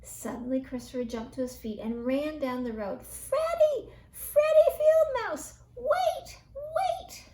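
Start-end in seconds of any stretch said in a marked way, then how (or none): 3.0-7.2 high, loud calling shout; little mouse calling after his friend far away. A narrator's reading. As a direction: call him back from far down the road. Calling after Freddie down road.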